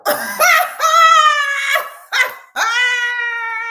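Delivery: high loud screaming laugh; long shrieking cackle; howling shrieking laugh. Real, not acted.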